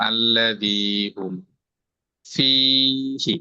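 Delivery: ফীহি with the ফী vowel held long, আল্লাযী হুম slow; slow recitation demo. Shown as a demonstration. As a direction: recite slowly, stretching ফীহি. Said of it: "জেরের মধ্যে আমরা টান দিয়ে বলতেছি ফীহি". (ধীরে তিলাওয়াত, ফীহি টেনে)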